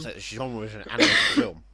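talking around mints in the mouth; words muffled by a mouthful; mouth full. Real, not acted. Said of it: "I've got Tic Tacs in my mouth".

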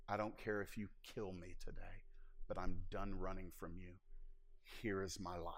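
soft, hushed retelling of a tearful prayer; retold tearful prayer. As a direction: quietly relive the broken prayer on the floor. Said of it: "In tears and inarticulate prayers".

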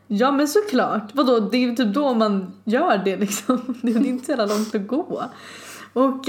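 ordinary talk, nothing marked

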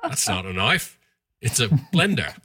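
mock Crocodile Dundee Australian; playful Australian bloke voice; Australian accent. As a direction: deliver the knife line as an Aussie. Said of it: "That's pretty good, [name]".

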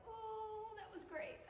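high, soft mock-waking "oh"; just-woke-up runner. Runner casually finishing a marathon as if just woken, mock sleepy. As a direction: play a runner fresh from a nap. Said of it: "look like they just woke up".

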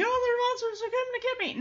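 high-pitched pretend panic; mock freaking out. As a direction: panic like a frightened cartoon dog. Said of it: "he's freaking out".

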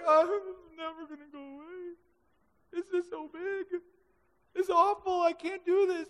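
whiny despair. Retold or imitated. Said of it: "next day it's like".

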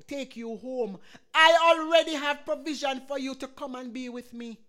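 rising, loud sermon delivery with surges; full-force preaching. Acted. Pastor exhorting the congregation in wartime fear, preaching at full force.

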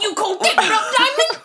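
high, loud hammed-up shout through laughter; mock actor yelling. Mocking Leo's Blood Diamond performance, high laughing shout. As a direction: ham it up like an overacting movie star.

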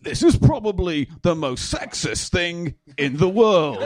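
slow, emphatic presenter impression; Clarkson. Host asked for an impersonation, slow pompous presenter voice. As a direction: draw it out with big pauses. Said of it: "Do your Clarkson impersonation".

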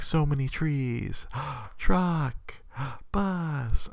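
high, slow wonder with little gasps; a small boy voiced high, gasping at trucks; awed toddler. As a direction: marvel at every tree and truck like a toddler. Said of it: "he's like".

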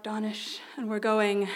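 ordinary delivery

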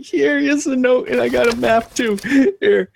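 very high, frantic offering; hurriedly appease them in a scared squeak; frightened surrender. Acted. Scared worker hands over note and map, frightened.

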